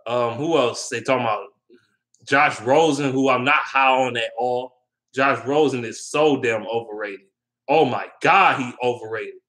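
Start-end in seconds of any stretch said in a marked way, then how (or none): none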